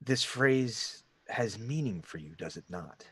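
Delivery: slow, hushed hooded figure fading very low; deep gravelly voice. Acted. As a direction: probe softly and slowly, sinking almost to nothing. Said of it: "it's in this deep, gravelly voice".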